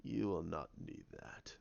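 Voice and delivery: low, soft villain voice; quietly commanding, deep murmur; Snoke. Retold or imitated. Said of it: "He goes".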